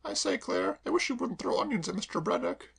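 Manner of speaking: lightly voiced young mistress; mild scolding young lady; Kay. Kay's lines read lightly raised.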